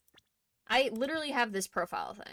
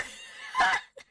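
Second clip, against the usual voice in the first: a very high, shouted burst of laughter; a sudden yelp of laughter; shrieking laugh. Real, not acted. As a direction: explode into a squealing laugh.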